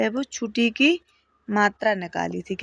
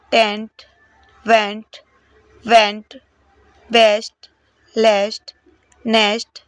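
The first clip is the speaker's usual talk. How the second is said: one word at a time, loud and clear; practice word drill; 5 dB louder, slower than usual. Shown as a demonstration. Teacher drilling short e word lists. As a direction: read each word out for pupils to copy. Drill list read aloud.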